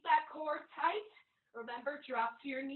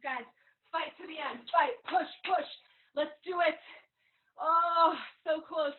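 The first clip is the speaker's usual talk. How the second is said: pumped-up coaching; 4 semitones higher, 5 dB louder than usual. Real, not acted. Trainer pushing through last reps.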